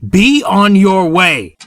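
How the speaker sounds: high, loud, slow command; shouting. A narrator's reading. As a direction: bark him on his way. Signalman shouting, losing patience.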